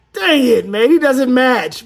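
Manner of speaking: real annoyance at the mismatch; frustrated outburst. Real, not acted. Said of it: "frustrated outburst".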